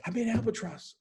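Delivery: high-pitched mock yell across the course; retold shout. Drunk friend yelling up from the rough, high mock shout. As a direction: call it out high like from far off. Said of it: "And he's shouting at me".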